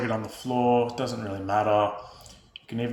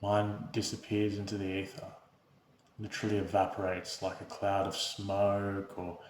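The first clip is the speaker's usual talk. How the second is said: soft, unhurried meditation voice with long pauses; guided meditation; 9 dB quieter than usual. Real, not acted. Host leading viewers through breath and body awareness, calm guided meditation. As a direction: guide them gently into stillness, hushed and even.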